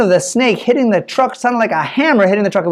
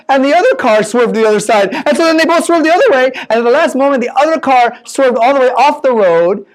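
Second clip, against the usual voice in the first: usual speaking voice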